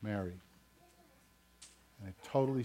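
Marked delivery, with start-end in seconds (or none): 0.0-0.3 one soft, low word; hushed imitation of Jesus calling a woman; quiet gentle call. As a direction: call her name very softly, lower. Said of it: "Jesus said one word to her".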